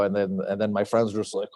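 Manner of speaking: usual speaking voice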